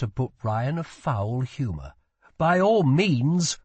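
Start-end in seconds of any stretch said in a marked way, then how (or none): 2.4-3.6 high, loud, drawn-out sarcasm; sarcastic royal dismissal, slow and raised; put-upon prince ordering servants out; irritated prince. A narrator's reading.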